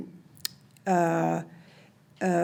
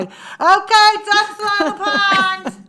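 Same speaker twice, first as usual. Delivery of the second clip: much higher, loud, slow call; Penny Marshall impression; 13 semitones higher, 9 dB louder, much slower than usual. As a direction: yell it out like the director. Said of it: "That's a really good impression of her".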